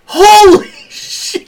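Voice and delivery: very high, loud, stretched-out reenacted shock; exaggerated astonishment, imitating own reaction; shocked exclamation. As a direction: drag out each word in a high, astonished cry. Retells own shocked reaction to reading.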